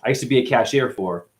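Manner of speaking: ordinary talk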